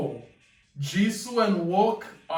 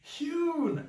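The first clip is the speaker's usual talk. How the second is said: calling his name high and urgent, mock scream; pitched-up calling of a name; retold high scream; 10 semitones higher, 4 dB quieter than usual. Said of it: "you got [name] screaming out".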